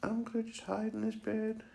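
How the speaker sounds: high, playful puppy voice; light little high-pitched voice; playful pet voice. Pretend. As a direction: pipe up high as if playing with a puppy.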